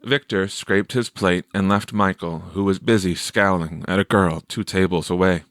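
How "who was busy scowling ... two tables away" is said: plain reading voice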